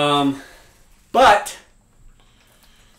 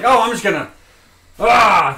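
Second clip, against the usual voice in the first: louder mock heave, imitating forcing it; mock brute; 7 semitones higher, 8 dB louder than usual. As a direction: ham up someone muscling through. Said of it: "Force is like".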